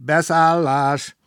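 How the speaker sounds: loud, high, drawn-out conductor's call; big shout. A narrator's reading. Conductor calls passengers aboard, big shout. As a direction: call it out long across the platform. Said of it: "a kalauz nagyot kiáltott".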